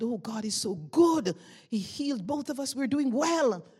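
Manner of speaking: re-enacted excited boast. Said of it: "I started to boast".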